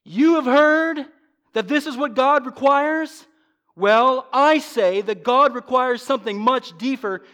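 high, loud challenge to the listeners; forceful proclamation. Retold or imitated. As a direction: proclaim it with emphatic authority. Preacher voices Jesus challenging the people.

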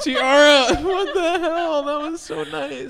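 high, slow and laughing. Real, not acted. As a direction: laugh it out in delight. Overwhelmed by compliment.

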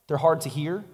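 usual speaking voice

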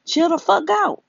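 real exasperation, pitch jumping high; high-pitched fed-up outburst; exasperated. Real, not acted. Exasperated plea to own audience.

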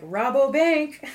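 slow, high, loud receptionist voice; imitating the voice answering the wrong number; mock phone greeting. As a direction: answer the phone like a bank clerk, drawn out.

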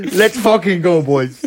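muffled, breathless helmet radio cheer; putting helmet on. Pretend. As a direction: shout it stuffed up inside a helmet. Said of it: "you sound like you're exhausted, like, stuffed up in your mouth on mic".

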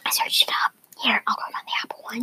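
quick hushed whisper. Real, not acted. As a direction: whisper it fast. Whispering into the mic.

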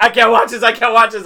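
high, loud imitation of a squeamish viewer; mock distress. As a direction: imitate someone who can't bear watching. Retelling partner's distress watching show.

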